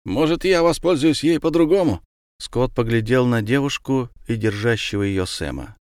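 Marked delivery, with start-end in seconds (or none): 0.0-2.0 higher-voiced soldier; third soldier. A narrator's reading. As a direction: call out in a raised pitch as another soldier. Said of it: "раздался голос третьего солдата".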